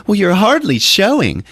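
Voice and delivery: high, loud, incredulous exclamation; mock disbelief. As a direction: react in a high put-on voice, astonished. Support group member reacts.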